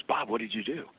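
soft, low, mildly choked-up retelling; imitated tearful caller. Mary phoning Bob in tears, softly imitated crying. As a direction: voice her hushed, crying phone call gently. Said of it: "she's crying on the phone".

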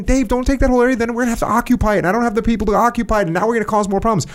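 high, rapid pleading objection; exasperated inner protest. Acted. Acted inner objection to Dave.

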